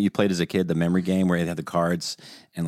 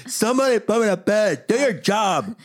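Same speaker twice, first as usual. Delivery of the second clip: high mock kid voice; 13 semitones higher than usual. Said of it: "retelling the son".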